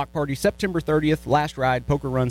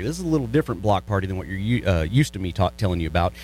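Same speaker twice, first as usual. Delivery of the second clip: ordinary delivery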